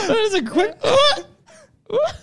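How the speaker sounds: high, broken by laughter; laughing. Real, not acted. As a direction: squeal it out laughing. Laughing after the shout.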